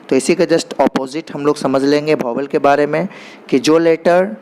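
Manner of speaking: ordinary talk, nothing marked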